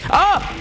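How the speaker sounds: sudden high yelp of fright; startled scream. Real, not acted. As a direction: yelp as the jump scare hits. Jump scare in game.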